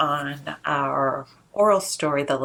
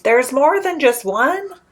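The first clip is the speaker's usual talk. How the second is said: blurted; 8 semitones higher, 6 dB louder than usual. Acted. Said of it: "Fortitude blurted".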